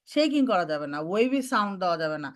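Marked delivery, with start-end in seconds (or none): none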